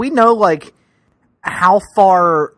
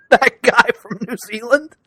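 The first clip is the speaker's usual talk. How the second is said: laughing disbelief; 6 semitones higher than usual. Real, not acted. Incredulous reply to co-host's claim.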